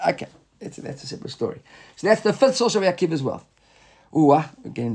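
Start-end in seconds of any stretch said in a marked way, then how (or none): 0.1-1.6 soft whispered remark to himself; whispered aside. Real, not acted. Teacher waves off a side question, in a whisper. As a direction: drop to a whisper, brushing the topic off.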